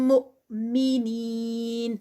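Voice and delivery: مؤمنین with the long ee held four counts, slow; four-count stretch. Shown as a demonstration. Teacher chanting the word for students, stretched. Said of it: "نین پر four counts".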